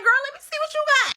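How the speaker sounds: high, loud and fast, gushing over her bag; excited girlfriend greeting. Retold or imitated. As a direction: squeal it out to a friend showing off purchases. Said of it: "So I'm like".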